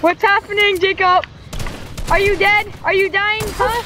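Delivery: high, loud startled questions; alarmed shouting. Real, not acted. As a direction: call out in alarm. Startled by a teammate.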